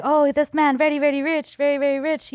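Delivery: lightly voiced gushing chauffeur; imitated chauffeur. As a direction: mimic an impressed chauffeur talking up his boss. Imitates chauffeur praising his boss.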